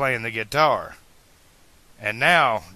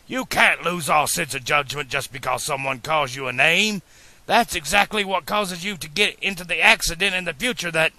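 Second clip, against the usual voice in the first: higher-pitched stern scolding; 5 semitones higher, 3 dB louder than usual. A narrator's reading. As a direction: scold him for poor judgment. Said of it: "he replied sternly".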